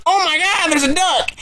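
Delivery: high, startled cry at the duck; excited, panicked cry. Retold or imitated. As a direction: yelp it out, caught off guard. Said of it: "and I was like".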